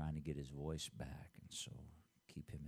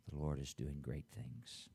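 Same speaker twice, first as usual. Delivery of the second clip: normal talking voice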